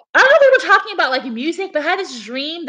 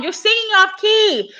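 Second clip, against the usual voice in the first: mock yell, pitched high; retold yelling; 8 semitones higher than usual. Retelling how he scolded her singing, mock yelling. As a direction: imitate an annoyed yell without much volume. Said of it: "He was, like, yelling".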